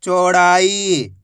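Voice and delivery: चौड़ाई as one slow word; syllables joined. Shown as a demonstration. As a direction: blend चौ, ड़ा and ई without pauses. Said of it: "direct मात्रा का उच्चारण करके".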